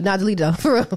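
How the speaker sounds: normal talking voice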